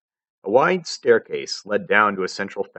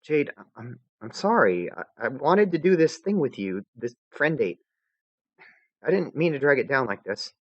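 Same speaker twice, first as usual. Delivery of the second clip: near tears; 7 semitones higher than usual. Acted. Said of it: "trying very hard not to cry".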